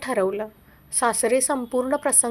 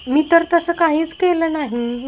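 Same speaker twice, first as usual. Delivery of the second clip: higher and louder, defending herself; upset protest; 4 semitones higher, 6 dB louder than usual. Acted. Scene: wife protests to her husband that she did nothing, raised and upset. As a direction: cry out in disbelief at the accusation.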